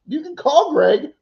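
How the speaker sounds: high, slow retort with a laugh; amused retort. Retold or imitated. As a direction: laugh through a retold comeback.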